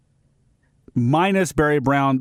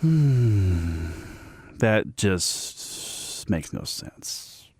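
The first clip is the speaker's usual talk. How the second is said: muttered aside; 8 semitones lower, 5 dB quieter, much slower than usual. Real, not acted.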